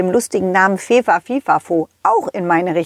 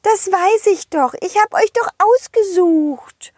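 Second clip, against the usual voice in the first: high fairy voice, soothing; tender fairy; 11 semitones higher than usual. Acted. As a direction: reassure the shoes as a fairy. Fee tröstet Schuhe. (liebevolle Feenstimme)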